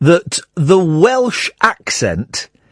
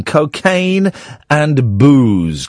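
"Cocaine and booze" drawn out slowly, weight on "Cocaine"; a slow, pointed "Cocaine"; slow emphasis; 3 semitones lower, much slower than usual. Shown as a demonstration. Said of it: "Here's the thing".

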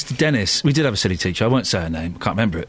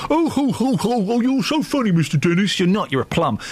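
high-pitched mocking fake laugh, then dropping into dismissal; mock-amused, falling to blunt put-down; sarcastic mock laughter; 6 semitones higher than usual. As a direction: sneer at a bad joke with exaggerated ho-ho-ho. Mocking the teacher's bad joke.

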